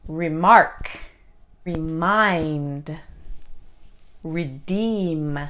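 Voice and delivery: reMARK, reMIND, reDEEM; light re, weight on the end of "Remark", "remind", "redeem"; second-sound stress. Shown as a demonstration. Teacher contrasting Remark, remind, redeem, second-sound stress. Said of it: "the second sound is stressed".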